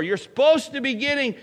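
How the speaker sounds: ordinary talk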